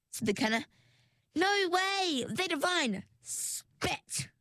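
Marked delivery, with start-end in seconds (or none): 1.3-3.0 high, giddy protest; delighted refusal. A narrator's reading. 3.2-4.3 hissed one-word order; sharp whisper. A narrator's reading. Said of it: "said Ringlet".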